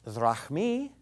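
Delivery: careful, low "drachmi"; Greek singular pronunciation. Shown as a demonstration. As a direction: quietly model "drachmi" with a Greek ee ending. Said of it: "if you take it in the singular".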